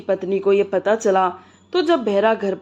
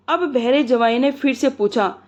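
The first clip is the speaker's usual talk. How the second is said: plain reading voice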